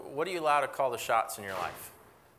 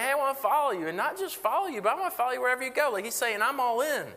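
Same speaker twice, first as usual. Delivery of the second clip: much higher, rushed eager pledge; eager would-be follower; 10 semitones higher, faster than usual. Retold or imitated. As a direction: gush with over-the-top keenness. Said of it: "somebody's coming to Jesus and saying".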